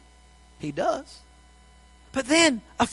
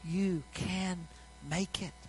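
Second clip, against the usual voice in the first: tender divine voice; 2 semitones lower, 15 dB quieter, slower than usual. Acted. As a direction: speak like God comforting a hopeless heart. God's voice speaking inside you.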